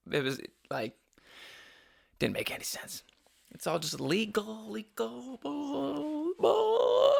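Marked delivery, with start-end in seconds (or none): 3.5-7.1 rising squeaky voice. Pretend. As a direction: pitch climbing higher and higher. Rising mock voice.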